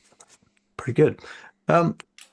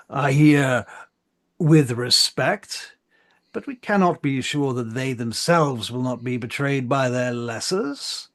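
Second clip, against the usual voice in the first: higher, slow, emphatic orator; suspicious council marshal; 4 semitones higher than usual. Acted. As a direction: cast doubt in measured public oratory.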